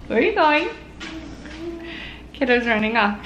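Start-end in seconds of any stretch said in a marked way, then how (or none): 0.1-0.7 playful call. Real, not acted. Mom calling after toddler.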